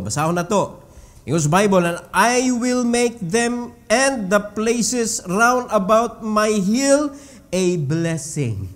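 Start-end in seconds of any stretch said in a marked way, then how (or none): 1.8-8.7 scripture reading. Acted. Reads scripture slowly.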